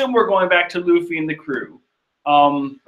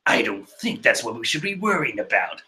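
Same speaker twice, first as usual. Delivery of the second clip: lightly voiced Perospero; 7 semitones lower, 5 dB quieter than usual. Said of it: "says Perot".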